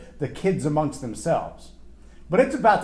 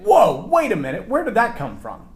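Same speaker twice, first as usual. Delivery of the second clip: high, louder alarmed double take; high startled "whoa"; startled parent; 7 semitones higher, 5 dB louder than usual. Retold or imitated. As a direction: catch yourself in surprise. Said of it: "And you think".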